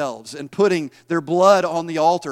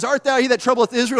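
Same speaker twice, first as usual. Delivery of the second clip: acted-out Ahab challenge; 7 semitones higher, 4 dB louder than usual. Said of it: "And I can see him. He says".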